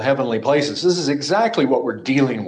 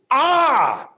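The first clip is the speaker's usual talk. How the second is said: sharp high cry ah, much higher; demon's scream; 12 semitones higher, 3 dB louder than usual. Shown as a demonstration. Pastor acts the demon's cry to his congregation. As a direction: let out a sudden anguished cry. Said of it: "This is a scream".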